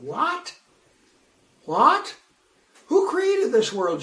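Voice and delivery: high incredulous What; retold disbelief. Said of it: "I read that and I said".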